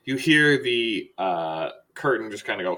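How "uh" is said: ordinary talk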